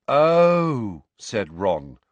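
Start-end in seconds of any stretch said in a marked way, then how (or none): 0.1-1.0 long, loud, higher-pitched realisation; dawning comprehension. A narrator's reading. Ron grasps why they are quarrelling, comprehension dawning. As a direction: let it dawn in a drawn-out exclamation. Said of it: "comprehension dawning across his freckled face".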